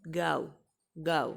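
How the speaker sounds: गाओ as 'gau', gliding a into u; careful two-vowel गाओ; diphthong demo. Shown as a demonstration. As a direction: repeat गाओ separately with a clear glide. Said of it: "ये क्या होता है".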